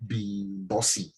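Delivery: low, slow retold apology; calmed down. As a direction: explain yourself in a subdued, measured way. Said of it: "So I calmed down".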